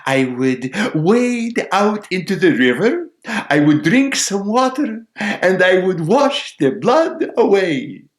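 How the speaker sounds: high, loud sparrow listing each step; confident sparrow. Acted. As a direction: build each step as the little bird. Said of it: "said the sparrow".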